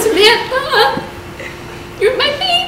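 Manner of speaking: high, slow, soft mock mother; pleading mom. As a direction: coo it like a worried mom.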